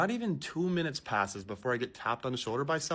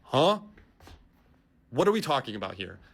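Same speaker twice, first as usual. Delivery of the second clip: baffled; 5 dB louder than usual. Retold or imitated. Falsely accused passenger, loud baffled huh. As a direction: react as if nothing makes sense.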